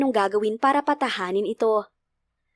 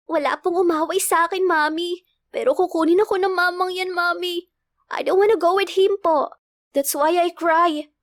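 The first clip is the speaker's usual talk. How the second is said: put-on high little-girl complaint; child tattling; 6 semitones higher than usual. A narrator's reading. Girl telling mother about the man, high childish complaint. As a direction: plead as an upset small child. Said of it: "Sumbong nito sa kanya".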